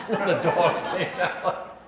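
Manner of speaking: words breaking into real laughter; laughing while talking. Real, not acted. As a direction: laugh through the end of the story. Amused at shoving friend toward dogs.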